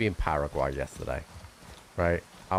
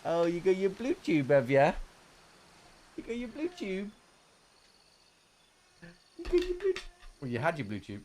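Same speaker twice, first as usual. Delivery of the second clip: put-on baby talk; 11 semitones higher than usual. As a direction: coo it to an infant. Cooing to the baby.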